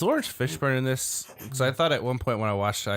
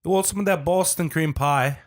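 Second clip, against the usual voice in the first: a higher, louder mock Boston that slips into British; attempted Boston accent; 8 semitones higher, 4 dB louder than usual. A friend's Boston attempt that drifts British. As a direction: try a Boston accent and miss. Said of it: "That was, like, British in there".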